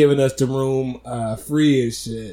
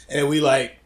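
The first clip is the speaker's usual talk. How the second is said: ordinary talk, nothing marked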